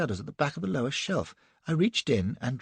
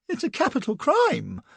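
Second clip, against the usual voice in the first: whimpered; 13 semitones higher, 3 dB louder than usual. A narrator's reading. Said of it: "I whimpered".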